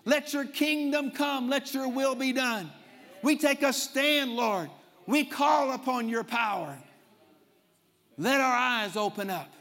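rising fervent prayer, building to shouts; preaching prayer. Acted. Leads a loud prayer.

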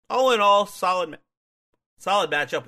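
words broken by hiccups. Real, not acted. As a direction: talk through the hiccups. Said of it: "Why can't I stop hiccuping?".

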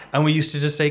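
normal talking voice

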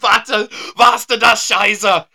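high, loud, drawn-out mock German; German accent. Joking after the accent jibe, loud German accent. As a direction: exclaim it in a hammed-up German accent. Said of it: "do a German accent".

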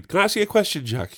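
high-pitched imitation of a chatty labourer; nosy gardener. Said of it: "He would say".

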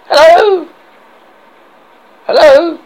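high, loud hail; calling out. Acted. Arthur hails the castle, calling out high and loud. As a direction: call up loudly to someone far off.

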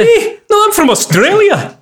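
high, loud, drawn-out exclamation; mock protest. Mocking the friend who picks up accents, high loud protest.

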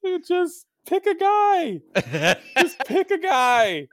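high, loud exasperated plea; exasperated outburst. Real, not acted. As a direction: wail it at your team in frustration. Exasperated fan pleading at his team.

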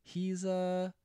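high, drawn-out mock hesitation; hesitant defense. Friend on the phone defending a man, hesitant. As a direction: imitate a friend hedging in a high voice.

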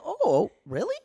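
high, loud retold surprise; intrigued surprise. Olivia learning he likes her, imitated intrigued surprise. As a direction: lift it up, pleasantly caught off guard.

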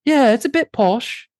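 high, put-on posh British; posh British accent. Shown as a demonstration. Host answering co-host's request, posh British. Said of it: "I thought there was a posh one".

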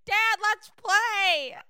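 put-on high-pitched little kid, drawn-out; excited child. Kid calling to dad at the door, excited. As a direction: beg to play in a high kid voice. Child waking parent, acted quote.

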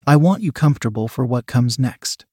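plain reading voice

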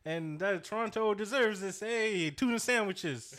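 hammed-up Canadian interview voice; mock Canadian accent. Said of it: "it was so Canadian".

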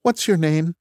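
high machine question; computer voice. Acted. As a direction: ask like a cold computer. Computer asks name.

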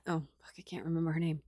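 fast, real unvoiced whisper to self; whispered aside. Real, not acted. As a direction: mutter the slip off-mic in a whisper. Reader forgets a name, aside.

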